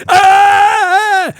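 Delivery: high loud furious shriek; rage scream. Retold or imitated. Boy grabs a knife and charges, raging high scream. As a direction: scream high and loud like a kid going nuts. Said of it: "he was like".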